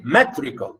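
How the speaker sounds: "metrical" stressed on its opening "me"; stress on first syllable. Shown as a demonstration. Teacher restating the correct stress, first syllable stressed. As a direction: punch "me" in "metrical", relax the rest. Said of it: "stress on m".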